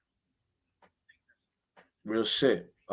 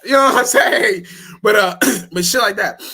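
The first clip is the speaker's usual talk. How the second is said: laughing; 9 semitones higher, 9 dB louder than usual. Real, not acted. Laughing at his own remix.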